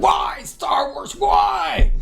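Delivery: high, drawn-out shout of despair; mock-agonized cry to the heavens; anguished wail. Fan lamenting the franchise to his co-hosts, anguished wail.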